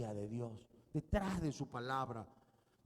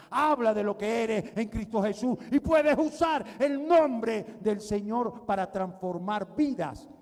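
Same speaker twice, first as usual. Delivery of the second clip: louder, exhorting preacher voice; fiery preaching; 4 semitones higher, 9 dB louder than usual. Acted. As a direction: urge the church with rising force. Preaching climax. (predicador exhortando con fuerza)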